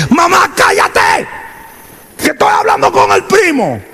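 mock yell of a rude kid; a New York cousin yelling at his mother to shut up.

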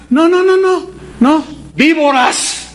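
high, emphatic rejection; John the Baptist snapping at hypocrites; angry rebuke. Acted. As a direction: shut them down hard.

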